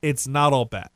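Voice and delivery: normal talking voice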